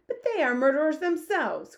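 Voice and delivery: loud, high-pitched objection; protesting cry. A narrator's reading. Army head objects to the Queen, crying out. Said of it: "cried the head of the army".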